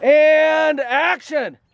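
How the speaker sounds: slow, sung-out cue to the actors; calling action. Real, not acted. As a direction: stretch it out like a director starting a take. Director calls action.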